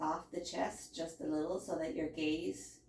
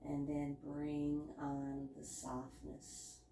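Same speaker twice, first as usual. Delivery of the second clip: hushed guided relaxation voice, much softer; soft spa whisper; 2 semitones lower, 14 dB quieter than usual. Acted. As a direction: soothe them into stillness, very softly. Guides relaxation in yoga pose.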